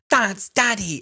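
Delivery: high, hammed-up cry of a little girl; mock childish squeal. Podcaster mocking the child running to the hologram, high squeal. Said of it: "When she's like".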